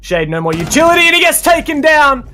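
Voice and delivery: loud, rising play-by-play call; esports caster. Real, not acted. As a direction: build the hype as the round closes. Live play-by-play climax.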